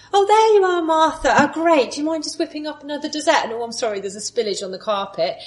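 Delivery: high, loud and fast, a careless demanding host; hammed-up put-upon chirp, rushing the requests; bossy mock Jesus. Preacher mocking a Jesus who orders Martha about, high and loud. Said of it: "what Jesus doesn't say".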